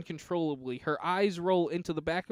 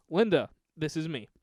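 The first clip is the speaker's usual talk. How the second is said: ordinary talk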